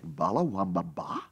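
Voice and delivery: low, soft, baffled repetition; puzzled echo. Retold or imitated. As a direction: echo it quietly to yourself in puzzlement. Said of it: "And you think".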